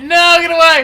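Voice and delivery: high, loud game yell; panicked yell. Real, not acted. Player chased by rival's tank, panicked yell. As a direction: shout to fend off a pursuer.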